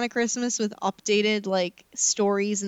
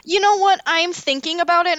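excited; 8 semitones higher, 4 dB louder than usual. Real, not acted. Sudden idea.

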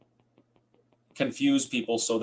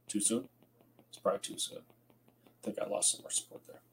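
sheepish whisper after a joke; whispered aside; 11 dB quieter than usual. Real, not acted. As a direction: drop to a guilty whisper. Joke aside after chemtrails jab.

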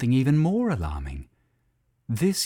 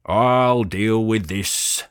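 slow, firm and louder, no nonsense; means business; 5 dB louder, much slower than usual. Acted. Bull Pinky stepping up to deal with the horse, firm and businesslike. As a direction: lay down the law to the cows. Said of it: "said Pinky in a voice that meant business".

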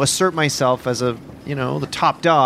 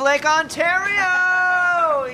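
put-on drunken shout; 11 semitones higher, much slower than usual. Yelling at the lake.

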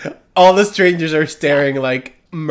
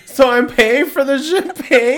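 high, raised-voice indignant question with laughter in it; mock scream; 9 semitones higher, 5 dB louder than usual. Her calling after him as he leaves the bar, mock scream. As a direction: call it out after someone walking away. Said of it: "she, like, screams it".

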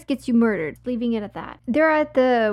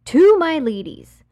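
loud, slow, high call-out; emphatic address; 6 semitones higher, 9 dB louder than usual. Real, not acted. Host to women listeners before advice, emphatic call-out. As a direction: hail the audience, stretching it out loudly.

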